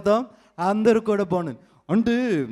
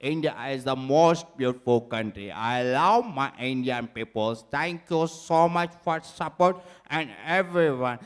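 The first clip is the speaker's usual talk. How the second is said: much lower, very slow broken English; slow politician English; 7 semitones lower, much slower than usual. Acted. Mimic thanks Indians as a much lower, very slow leader. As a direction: drag each English word out like a leader.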